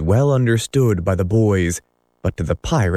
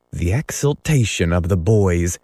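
ordinary delivery

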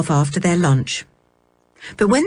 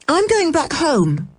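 put-on girl voice; 9 semitones higher than usual. A narrator's reading. George voiced.

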